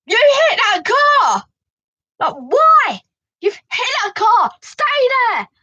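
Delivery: high, loud angry yell at the driver; retold shouting. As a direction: shout her down like the angry mum. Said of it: "she was shouting at me".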